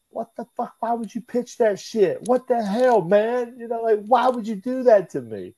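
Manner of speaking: high, incredulous protest, voice jumping up; outraged disbelief. Retold or imitated. Young wrestler protesting to JBL, high and incredulous. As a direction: cry out in disbelief at being left undrafted. Outraged retort to JBL's pitch.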